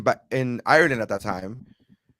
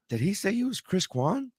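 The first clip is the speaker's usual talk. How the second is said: high-pitched, softer puzzled question; baffled disbelief; 9 semitones higher, 5 dB quieter than usual. Retold or imitated. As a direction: replay your confusion, voice lifted high. Baffled by stranger's introduction.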